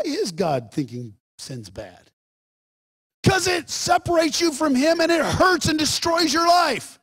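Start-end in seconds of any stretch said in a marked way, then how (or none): 3.2-7.0 high, emphatic sermon cry; impassioned preacher's answer; forceful preaching. Real, not acted. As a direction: drive the warning home in a raised voice.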